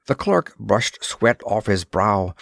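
ordinary delivery

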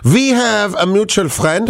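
put-on German accent. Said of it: "I'm allowed to do the German accent".

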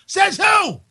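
indignant shout. Real, not acted. Outraged reaction to news.

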